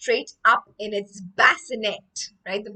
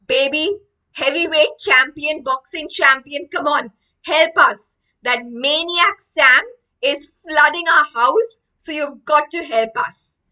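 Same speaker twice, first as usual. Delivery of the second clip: loud, raised rallying call; urging plea; 5 semitones higher, 8 dB louder than usual. Acted. William urging the baby to save the house, loud plea. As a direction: egg on the champion to help.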